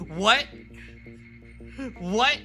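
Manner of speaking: usual speaking voice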